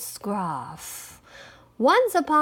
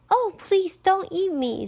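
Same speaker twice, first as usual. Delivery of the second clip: high, pleading little goat; 9 semitones higher than usual. A narrator's reading. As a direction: beg in a small high voice. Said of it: "in a very little voice".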